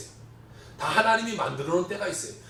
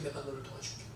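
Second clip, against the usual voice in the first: 내가 너를 도와줄게 repeated much softer, almost fading; soft echo; 5 semitones lower, 15 dB quieter than usual. Retold or imitated. God's promise echoed softly to the missionary. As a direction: let it fade like an echo.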